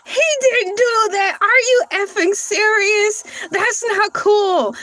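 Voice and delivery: stunned outrage; shocked. Real, not acted. Shocked reaction to news.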